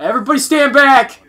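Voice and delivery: loud mock warning shout; yelling. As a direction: warn everyone loudly.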